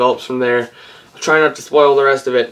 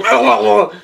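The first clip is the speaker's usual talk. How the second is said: short laugh; 7 semitones higher than usual. Real, not acted. Laughs at glitch.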